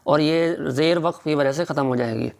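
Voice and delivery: usual speaking voice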